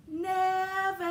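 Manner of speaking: ringing, sung-out yell; shouted call. A narrator's reading. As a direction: shout the name down the street. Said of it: "and now he shouted".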